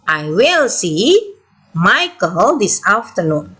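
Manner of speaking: very slow, rising on "see", a break before "Michael"; teacher spelling out the reading, lingering after "I will see"; measured pausing demo. Shown as a demonstration. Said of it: "Berhenti sejenak". (dibaca pelan, berhenti sejenak setelah "see")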